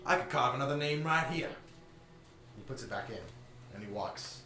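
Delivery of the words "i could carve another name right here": menacing threat. Acted. NPC threat.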